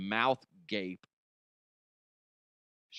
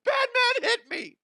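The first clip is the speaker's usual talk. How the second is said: much higher, louder crybaby whine; whiny child LeBron; 8 dB louder than usual. Retold or imitated. Mocking LeBron tattling to the ref, high crybaby whine. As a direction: wail it like a tattling kid.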